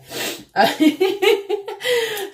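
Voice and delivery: loud high squealing laugh; laugh near tears. Real, not acted. Trying not to cry over lash makeup, high squealed laugh. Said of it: "I'm trying not to cry".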